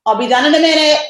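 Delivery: loud, high call to the next room. Real, not acted. Mom calling to her screaming kids, loud and high.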